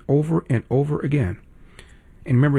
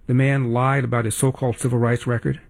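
ordinary delivery

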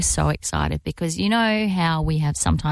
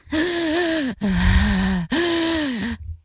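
imitated gasp; 6 semitones higher than usual. Mimicking the panting man.

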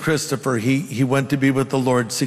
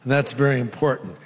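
ordinary delivery

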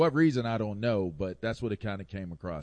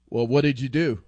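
loud, higher-pitched mock parent confronting a kid; scolding parent; 6 semitones higher, 11 dB louder than usual. Parent to a kid in trouble, sternly demanding. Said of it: "explain myself out of trouble to my parents".

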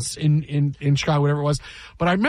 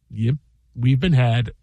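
wry anchor calm; 5 semitones lower, slower than usual. Retold or imitated. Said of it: "I think the smile just".

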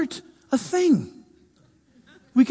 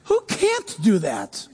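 normal talking voice

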